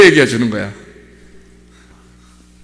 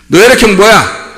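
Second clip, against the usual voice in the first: higher, insistent questioning; forceful demand; 6 semitones higher, 4 dB louder than usual. Acted. Preacher demanding someone's blood type, forceful demand. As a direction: press the question hard on the listener.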